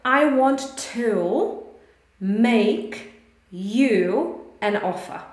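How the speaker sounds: I want to, make, you an offer, said slowly with pauses between; slow, spaced demo. Shown as a demonstration. Teacher slowing the sentence down to show where to goes. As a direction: spell out the gap before make for the class. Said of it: "So have a look".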